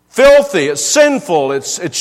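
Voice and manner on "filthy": Filthy thrown out high and loud; preacher punching the word Filthy, loud; emphatic exclamation. Real, not acted. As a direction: spit out Filthy with disgust.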